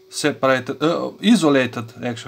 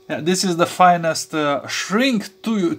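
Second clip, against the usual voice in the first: usual speaking voice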